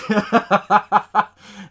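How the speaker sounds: big amused laugh; loud laugh. Real, not acted. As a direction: burst of real laughter. Laughs at own story.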